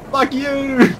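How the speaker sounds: high loud taunt; shouting. Real, not acted. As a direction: yell at them. Taunting opponent.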